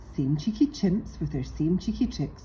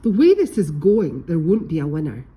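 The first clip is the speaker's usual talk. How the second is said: scolding; 8 dB louder than usual. A narrator's reading. Polly scolds fighting pirates.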